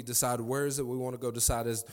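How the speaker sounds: ordinary talk, nothing marked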